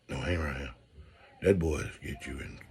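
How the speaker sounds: low hushed caution from grandma; hushed grandma warning. Retold or imitated. Grandmama warning grandkid off Zeke, low and hushed.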